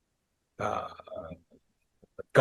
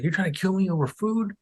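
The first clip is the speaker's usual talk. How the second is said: higher-pitched incredulous question; mock disbelief; 9 semitones higher than usual. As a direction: imitate a kid who can't believe it. Teen's disbelief in retold quarrel.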